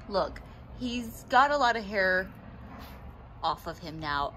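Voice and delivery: ordinary talk, nothing marked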